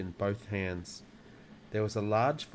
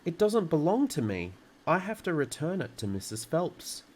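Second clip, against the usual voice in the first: put-on higher, urgent child's protest; crying out in protest; 7 semitones higher than usual. A narrator's reading. Matilda must return the book to Missus Phelps, protesting. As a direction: plead your case, voice raised in pitch. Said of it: "Matilda cried".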